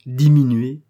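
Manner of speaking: "diminuer" hit loud and rising; "diminuer" punched loud, rising at the end; stressed word. A narrator's reading. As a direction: land hard on "diminuer". Narrator stresses the shocking verb.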